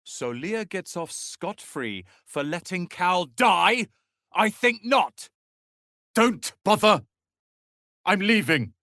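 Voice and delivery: rising outrage ending in a walkout; indignant anger. Acted. Storming off after argument.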